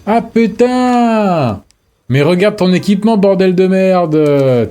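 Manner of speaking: high, slow exasperated swearing; frustrated outburst. Real, not acted. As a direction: drag out the curse in exasperation. Frustrated after dying in game.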